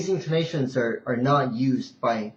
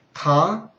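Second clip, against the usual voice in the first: TA rising to high; second tone; 6 semitones higher, 5 dB louder than usual. Shown as a demonstration. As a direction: lift TA like a question. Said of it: "second ta".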